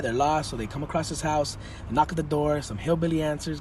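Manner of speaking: ordinary talk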